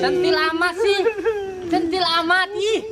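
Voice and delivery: high, coy and giggly spirit voice; possessed flirty woman. Acted.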